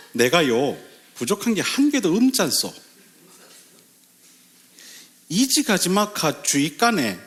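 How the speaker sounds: Gangwon dialect with swooping pitch. Shown as a demonstration. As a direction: recite in a sing-song put-on Gangwon accent. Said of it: "강원도도 있어요".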